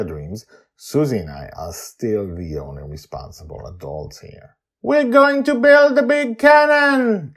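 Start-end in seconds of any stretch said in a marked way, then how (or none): 4.8-7.3 put-on high, loud, slow kid shout; child shouting down. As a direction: shout down proudly from the deck. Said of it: "Joy shouts down at us".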